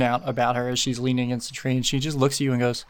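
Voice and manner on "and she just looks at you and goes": usual speaking voice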